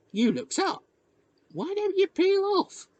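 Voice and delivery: put-on very high country-boy voice; village boy. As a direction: suggest it up high like a local lad. Village boy urges the newcomer.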